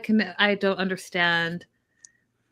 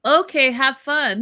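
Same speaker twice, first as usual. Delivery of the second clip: dismissive brush-off; 4 semitones higher, 6 dB louder than usual. Retold or imitated. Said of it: "That man doesn't want you".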